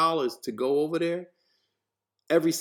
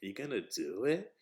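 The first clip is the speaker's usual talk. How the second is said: lower, softer puzzled question; confused; 4 semitones lower, 10 dB quieter than usual. Acted. Mother questioning her son's new job, confused. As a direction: ask it quietly, rising in confusion. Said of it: "like, confusion".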